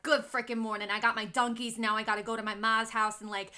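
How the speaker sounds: hammed-up New England guy voice; New England accent. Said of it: "this guy was like".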